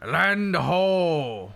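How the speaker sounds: loud, drawn-out pirate cry; pirate accent. Shown as a demonstration. Streamer showing a pirate phrase, pirate accent lookout call. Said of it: "to use with a pirate accent".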